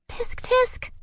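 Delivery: high unvoiced bird cheep. A narrator's reading. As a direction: cheep it like a fussy little bird. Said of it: "cheeped Kalinka".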